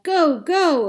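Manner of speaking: high, loud sudden yell; sudden urgent yell. A narrator's reading. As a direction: burst into action with a yell. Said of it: "Suddenly he yelled".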